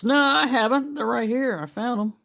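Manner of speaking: goofy high-pitched denial; comic squeaky protest. Pretend. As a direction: squeak it like a cartoon kid. Comic reply, much higher voice.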